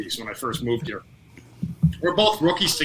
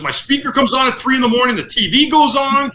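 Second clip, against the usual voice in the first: higher-pitched imitation of a bewildered complainer; mock exasperation, pitched up; retold exasperated complaint; 9 semitones higher, 4 dB louder than usual. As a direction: retell it as a worked-up gripe. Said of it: "had complained now for, like, the tenth time".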